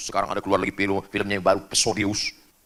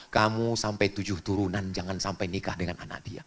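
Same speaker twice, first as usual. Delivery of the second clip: hushed, fading imitation of last words; deathbed whisper; 4 semitones lower, 8 dB quieter than usual. Dying parent leaving a bitter last wish, hushed. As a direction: act out a dying person's low murmur. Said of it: "bisikannya, wasiatnya".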